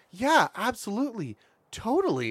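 high-pitched, over-eager agreement; wrong, earnest tone. Acted. Game character agreeing far too earnestly, high and eager. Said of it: "that's not the tone I wanted".

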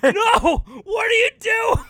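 high, loud alarmed yelp; mock panic. Cameraman attacked by the bear, acted panic. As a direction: yell in pretend alarm.

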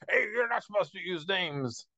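high, put-on cartoon voice; squeaky annoyed cartoon scold; cartoon character voice. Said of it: "Strong Bad and Bubs arguing".

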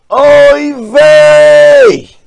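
high, loud Yiddish cry of dismay; yiddish accent. Acted. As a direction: shout oy vey in despair. Ames sees his own keys.

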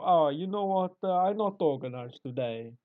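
comic Italian slacker, high at the start; Italian accent. Acted. Italian worker begging off organising, mock Italian accent. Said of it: "why are they so good at organised crime".

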